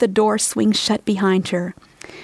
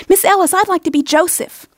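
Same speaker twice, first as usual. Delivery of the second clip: put-on eager child; 9 semitones higher, 3 dB louder than usual. A narrator's reading. Eager child begs for part.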